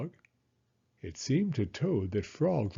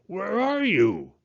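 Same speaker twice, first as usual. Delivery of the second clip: worried Toad; 10 semitones higher, 5 dB louder than usual. Acted. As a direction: ask like you can't find him. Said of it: "said Toad".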